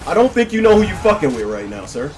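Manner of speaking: loud, high-pitched trash talk; riled-up exclaiming. Real, not acted. Gamer to his opponent after a near miss, riled-up shouting.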